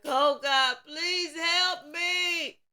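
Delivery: high, loud, slow cry for help; desperate scream. Retold or imitated. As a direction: shout for help as someone in danger. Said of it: "Somebody is screaming".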